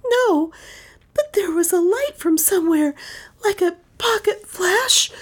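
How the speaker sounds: high, airy distraught voice; high-pitched, shaken recollection; shaken witness. A narrator's reading. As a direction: answer high and frightened, fading to whispery. Shaken witness answers the detective.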